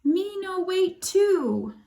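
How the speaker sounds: put-on high, slow toddler voice; squeaky little baby brother. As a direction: talk like a tiny toddler. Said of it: "Baby Louie agreed".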